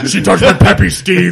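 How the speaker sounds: loud goofy clone impression; menacing butcher. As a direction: blurt it like a dopey clone. Quoting Multiplicity clone line.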